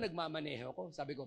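normal talking voice

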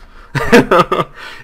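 put-on a polite host's laugh, accented; hearty character laugh.

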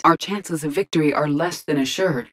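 ordinary talk

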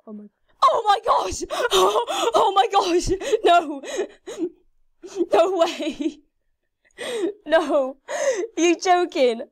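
high, loud gasping dismay; shocked disbelief. Real, not acted. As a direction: cry out as if it can't be true. Shocked by setback at milestone.

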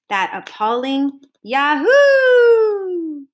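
loud, slow, drawn-out cry of mock outrage; relished exclamation. A narrator's reading. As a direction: savour the insult, stretched and loud. Narrator relishes the insult.

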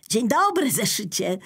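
high, bright-eyed bear voice, proud of his jump; the teddy bear greets the notebook, full of pride; proud little bear. Acted. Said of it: "nie ukrywając dumy".

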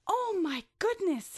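high, bright shocked cry; startled gasp. Acted. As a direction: gasp it out in sudden alarm. Billy startled by his wings.

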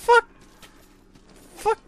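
loud, high snarl of fuck you at the enemy; furious curse. Real, not acted. Gamer cursing the enemy that hit him, furious. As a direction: spit it out in real rage.

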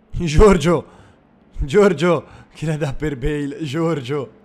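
loud shout to a teammate; calling out. Acted. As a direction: yell his name across the pitch. Calling out to a player.

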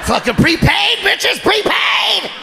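gloating, loud, drawn out; triumphant yell. Pretend. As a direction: yell it like a victory cry.

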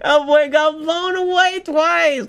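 high, slow words through laughter; laughing. Real, not acted. Laughing as Luffy gets blown away again, high laughter.

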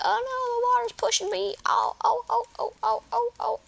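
high panicky zombie squeals. Pretend. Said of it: "Let's pretend that I'm a zombie".

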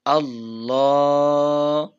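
আল্লাহ held long on one alif; stretched Allah. Shown as a demonstration. Teacher showing the alif stretch, drawn out. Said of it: "আল্লাহ এক আলিফ টান".

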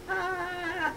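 high, soft, drawn-out "ah"; nervous shaking sound. Shown as a demonstration. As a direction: demonstrate nervous trembling with a small high sound. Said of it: "his hands are shaking like this".

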